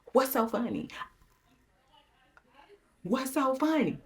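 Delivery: high, indignant reenacted demand; retold indignation. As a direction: act out being offended by the laughter. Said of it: "Then I got mad".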